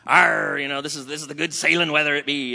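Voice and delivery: loud hammed-up pirate growl; pirate sailor. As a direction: open on a big roaring "arr" and talk like a pirate. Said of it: "can I stay with the pirate thing".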